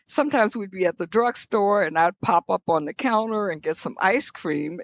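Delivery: ordinary delivery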